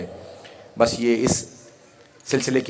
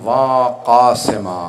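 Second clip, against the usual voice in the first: long-drawn, louder wail of lament; mourning cry; 5 dB louder, much slower than usual. Retold or imitated. Bereaved woman calling out for her martyr, drawn-out lamenting cry. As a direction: draw out each vowel in imitated grief. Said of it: "याद करके रो रही हैं".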